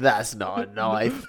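loud, drawn-out Australian movie line; slow, exaggerated Australian twang; mock Australian accent. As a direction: ham up an Australian accent through laughter. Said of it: "That's Australian".

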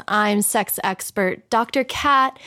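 ordinary talk, nothing marked

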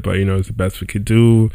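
ordinary delivery

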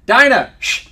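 sharp, loud call of a name; sudden loud summons. Real, not acted. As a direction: call out to get her attention. Calling out sharply to Dinah.

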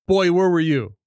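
loud, high, angry demand; imitated pissed-off dad. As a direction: confront your kid like a furious father. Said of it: "he's super pissed at me".

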